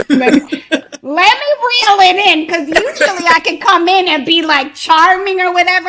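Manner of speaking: high-pitched, laughing through the words. Real, not acted. Laughing while reining herself in.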